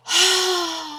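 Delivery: high, drawn-out weary sigh; heavy sigh. Real, not acted. Fed up with the long scene, weary sigh. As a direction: let it all out. After the shout.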